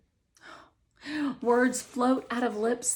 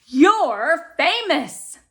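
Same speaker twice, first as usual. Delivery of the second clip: higher, louder and slow, bursting with news; excited mom; 8 semitones higher, 11 dB louder, much slower than usual. Acted. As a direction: mom can't hold in the news.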